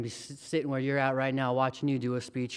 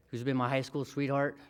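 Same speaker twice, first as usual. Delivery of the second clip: usual speaking voice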